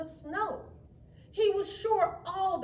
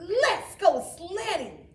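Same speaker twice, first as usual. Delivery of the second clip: slightly higher, slow and keen; eager suggestion; 7 semitones higher, 7 dB louder than usual. A narrator's reading. Paul suggesting sledding, eager. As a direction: pitch the idea. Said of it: "Paul answered".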